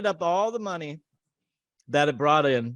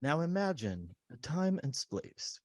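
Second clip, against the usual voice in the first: slow, hushed narrator intoning an eerie intro; Twilight Zone narrator; 8 dB quieter, slower than usual. Retold or imitated. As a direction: attempt the Twilight Zone host, soft and halting. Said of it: "I can't even do that guy's accent".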